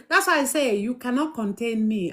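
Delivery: normal talking voice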